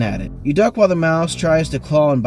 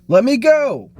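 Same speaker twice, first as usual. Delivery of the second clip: high, louder yell; desperate high shout; 10 semitones higher, 5 dB louder, slower than usual. A narrator's reading. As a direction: shout to be let go. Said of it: "you yell".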